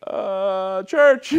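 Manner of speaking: hammed-up high, hesitant squeak; sheepish confession. Teen owning up to mom about church, mock sheepish. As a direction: imitate a cornered teen, pitch way up. Child nervously confessing to mother.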